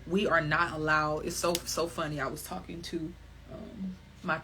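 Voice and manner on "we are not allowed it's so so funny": ordinary talk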